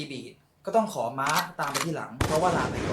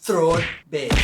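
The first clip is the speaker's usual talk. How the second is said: name it with a loud punch; loud announcement; 10 dB louder than usual. Acted. Teacher announces the technique's name loudly. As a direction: call out the title like a show card.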